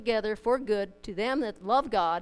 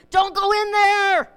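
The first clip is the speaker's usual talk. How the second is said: loud, high, drawn-out warning yell; 8 semitones higher, 10 dB louder, slower than usual. Acted. Movie watcher warning a character on screen, loud yell. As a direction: holler a stretched-out warning at the screen. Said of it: "we yell at the screen".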